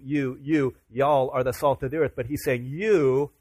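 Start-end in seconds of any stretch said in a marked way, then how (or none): 2.6-3.3 slow, pointed stress on "you"; emphatic you. Shown as a demonstration. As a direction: stretch out "you" slowly, leaning on it. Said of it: "emphatically and you only".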